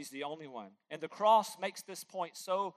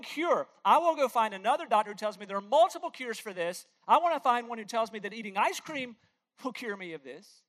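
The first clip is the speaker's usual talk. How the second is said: high, loud and outraged, peaking at "How dare you"; a preacher hamming up a patient insulted by one cure; mock indignant patient; 6 semitones higher, 6 dB louder than usual. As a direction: play an offended patient scoffing at the doctor. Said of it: "Would anybody here say".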